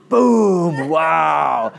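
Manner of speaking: loud, drawn-out mock amazement; high burst of showy wonder; triumphant shout. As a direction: belt it like a mind-blowing reveal. Preacher celebrating his joke insight.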